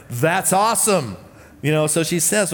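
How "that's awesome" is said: higher, slow, drawn-out delight; acted motherly excitement; delighted mom. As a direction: stretch it out like a proud, impressed mother. Mom's thrilled reaction to son's news.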